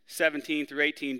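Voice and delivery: normal talking voice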